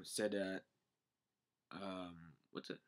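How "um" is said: usual speaking voice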